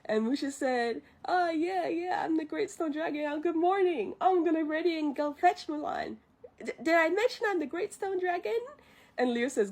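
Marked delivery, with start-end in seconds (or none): 1.2-8.6 high-pitched, eager mock bluff; acted chirpy cover-up in a high voice; bluffing impostor. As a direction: pose as someone grander, pitched up. Mushu poses as stone dragon.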